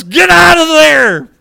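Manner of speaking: high, loud, stretched-out yell; alarmed warning shout. Retold or imitated. Roper warning friend as cow charges, alarmed shout. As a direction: shout a drawn-out warning across a clearing. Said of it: "And I was".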